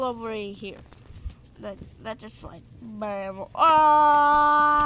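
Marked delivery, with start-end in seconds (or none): 3.5-4.8 a sharp, loud scream that bursts out; startled scream. Real, not acted. Gamer falling off a ledge, loud startled scream. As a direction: yelp out loud as you fall. Sudden fall in the game.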